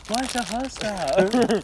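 high, loud, slow drawn-out whine; mock whiny voice. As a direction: stretch it out in a silly high voice. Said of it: "Kevin says".

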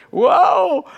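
hammed-up sudden wobble of surprise; mock startled awe. Acts Peter startled on the waves.

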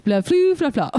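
high, loud garbled fake French; mock French gibberish. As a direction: babble like he can't speak French. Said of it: "he's like".

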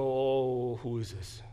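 retold wonder, falling away; mock awe. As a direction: imitate awestruck sailors with a stretched Oh. Retold sailors amazed at calmed sea.

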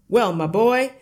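loud, booming, boastful father; noisy, self-satisfied bluster; hearty shout. A narrator's reading. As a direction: shout it out like a cocky man of the house. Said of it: "slapped his son on the back and shouted".

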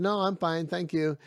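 put-on proud young man waving off help; proud refusal. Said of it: "The young guy said".

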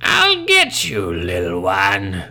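loud, slow monstrous snarl; villain threat. Acted.

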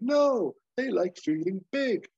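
smug over-the-top pitch; Hawk explaining. Retold or imitated. Hawk explaining his coffee plan, hammed high. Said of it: "And Hawk's like".

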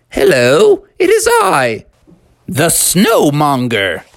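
loud, grandiose snowman announcement; Snowmonger. Acted. Snowman comes to life and introduces himself, grandiose creature voice. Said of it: "the snowman's head swiveled".

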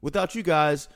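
loud, fast, high outburst of disbelief; mock exasperation. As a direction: throw up your hands in mock despair. Exasperated at impossible question.

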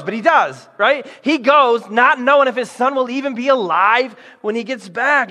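ordinary talk

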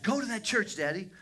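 high, loud imitation of a daughter; urgent urging. Daughter sending her father to church, loud and urgent. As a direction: call it out high and insistent.

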